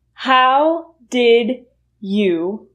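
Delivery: How did you, each word slow and separate; slow full form. Shown as a demonstration. Teacher showing the full form slowly. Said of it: "will reduce to".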